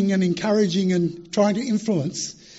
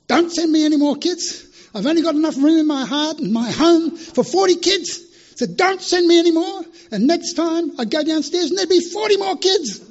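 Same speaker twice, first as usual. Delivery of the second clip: high, loud exasperated plea to God; yelling prayer; 9 semitones higher, 5 dB louder than usual. Retold or imitated. Missionary complaining to God from the roof, yelling. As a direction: yell it upward as if God won't listen. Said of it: "You yell at me every night".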